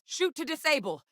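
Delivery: urgent, high-pitched battlefield shout; shouted combat order. Acted. Officer ordering the squad to spare the sample, shouted. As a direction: call orders out over a firefight.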